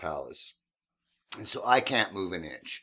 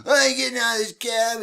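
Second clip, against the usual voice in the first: put-on drunk yelling; 14 semitones higher, 6 dB louder than usual. Drunk passenger refusing to get out, yelling. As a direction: drag it out, drunk and defiant.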